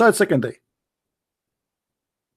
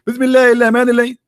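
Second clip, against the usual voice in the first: rattled off fast in a higher put-on voice; mock rote recitation; 5 semitones higher, 3 dB louder, much faster than usual. Speaker mocks a believer reciting without understanding, fast and high. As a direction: parody someone reciting by rote, racing through. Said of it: "I can recite the word with you".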